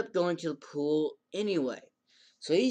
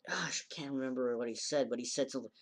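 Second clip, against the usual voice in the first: hushed aside to self; soft unvoiced muttered aside; whispered aside; 6 dB quieter, faster than usual. Real, not acted.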